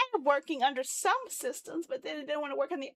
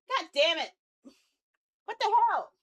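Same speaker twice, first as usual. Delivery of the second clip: rising burst of frustration; real annoyed outburst; exasperated cursing; 4 semitones higher, 5 dB louder than usual. Real, not acted. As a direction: curse at a failed test, getting higher and louder. Frustrated as his test fails.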